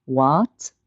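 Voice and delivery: WHAT sweeping upward like a question; rising tone. Shown as a demonstration. Teacher shows Mandarin second tone, WHAT rising steadily. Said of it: "Second tone rising".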